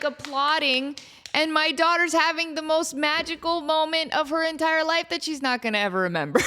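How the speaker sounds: ordinary talk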